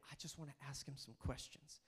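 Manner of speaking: soft, low inner voice; thinking to himself. Acted. Nicodemus thinking before seeing Jesus, quiet inner thought. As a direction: drop it down like a private thought. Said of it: "but he was thinking".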